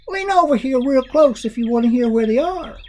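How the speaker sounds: put-on high coaxing old lady; Grandma's voice. A narrator's reading. Grandma luring the monster closer, in a high coaxing voice. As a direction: sweetly lure him in, pitched high. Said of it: "she said".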